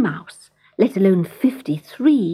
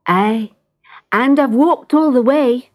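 lightly Scottish cat voice; a touch of a Scottish brogue, a little higher; Scottish accent; 4 semitones higher, 4 dB louder than usual. Acted.

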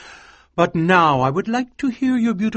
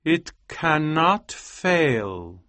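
very slow, higher, solemn delivery; slow assurance; 4 semitones higher, much slower than usual. A narrator's reading. Scientist showing his wife the potion, slow solemn assurance. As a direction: stretch out every word with certainty.

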